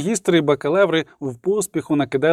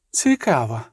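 drawn-out; 4 semitones lower than usual. A narrator's reading. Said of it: "протяжно промовив ельф".

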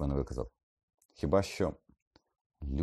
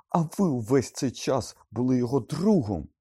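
high, loud accusing shout; voice breaking, furious; 10 semitones higher, 8 dB louder than usual. A narrator's reading. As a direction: throw it out in agitated fury. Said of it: "Його голос зривався від хвилювання".

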